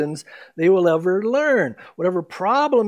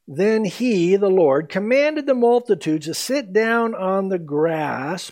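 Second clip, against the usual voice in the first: slow, louder Bible reading; scripture reading; 3 semitones higher, 4 dB louder, slower than usual. Acted. Pastor reading verse nineteen aloud, slow and measured. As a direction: read the verse with weight.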